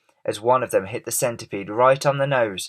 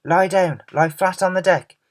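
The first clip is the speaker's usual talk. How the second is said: urgent warning; 8 semitones higher, 4 dB louder than usual. A narrator's reading. James shouting a warning.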